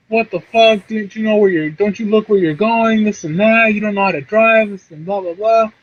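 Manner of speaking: high-pitched mock rant; imitated angry yelling. Imitating the other driver after the crash, angry yelling. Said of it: "she starts yelling at me".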